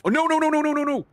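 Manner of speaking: panicked, high and loud no's; alarmed cry of no, rising. Real, not acted. Sudden disaster in the game.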